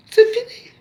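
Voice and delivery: a leap up in pitch to close it; sudden high cry. Real, not acted. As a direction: fling it out high and final. (cri aigu et soudain, sans appel)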